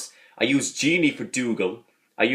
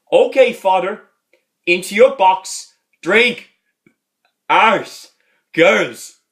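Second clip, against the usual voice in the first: much higher, louder barked words; hammed-up grumpy old man; old priest shouting; 7 semitones higher, 7 dB louder, much slower than usual. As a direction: bark each word. Jack's shouted words in retelling.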